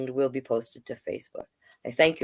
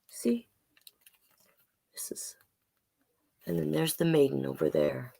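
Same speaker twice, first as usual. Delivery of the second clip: usual speaking voice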